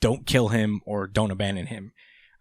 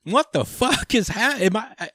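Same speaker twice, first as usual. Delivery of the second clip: much higher, stammering outrage; flustered disbelief; 11 semitones higher than usual. Retold or imitated. Code sputtering at being called a hindrance, shrill disbelief. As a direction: sputter like you're losing your mind.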